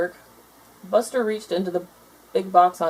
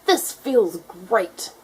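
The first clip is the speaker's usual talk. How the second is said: slow contented gator; much slower than usual. A narrator's reading. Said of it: "said Bridget".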